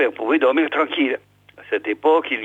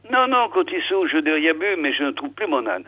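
ordinary delivery

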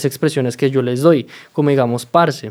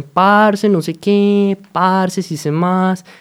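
Parce called out higher; slang intonation; 5 semitones higher, much slower than usual. Shown as a demonstration. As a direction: lean on Parce, then slow down. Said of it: "Ellos dicen".

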